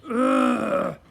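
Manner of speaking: loud, high grunt; pained grunt. Acted. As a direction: groan as if landed on. Lawn hit by a crash.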